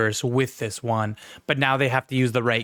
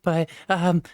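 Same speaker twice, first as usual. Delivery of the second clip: higher, hesitant bashful voice; shy, insecure voice; 6 semitones higher than usual. Pretend. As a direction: play it meek and unsure. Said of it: "prodding your little fingers together".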